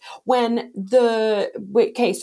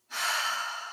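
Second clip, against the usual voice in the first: long weary unvoiced sigh; withering sigh; 9 dB quieter than usual. Real, not acted. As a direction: let out a tired, withering sigh. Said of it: "Why are you sighing at me".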